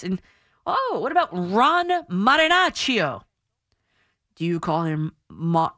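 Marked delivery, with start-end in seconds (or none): 1.3-3.2 "Marinaccio" slow and raised, ending "NAH-cho"; Italian pronunciation. Shown as a demonstration. Host asking critics about a pitcher's name, Italian pronunciation. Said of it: "You say them in Italian too".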